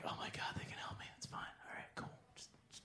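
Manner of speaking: whispering to himself, fast at the end; a breathless hopeful whisper; hushed whisper. Retold or imitated. Stranded in the snow, whispering hope to himself. Retold hopeful thoughts at night.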